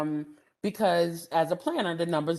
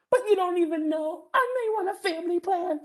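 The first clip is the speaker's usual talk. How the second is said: mocking objector; 10 semitones higher than usual.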